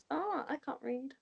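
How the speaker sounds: usual speaking voice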